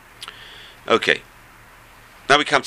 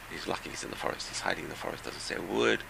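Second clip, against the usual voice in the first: hushed telling; 2 semitones lower, 13 dB quieter than usual. A narrator's reading. Man hides silently in the forest.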